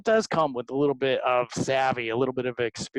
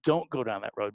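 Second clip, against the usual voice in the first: ordinary talk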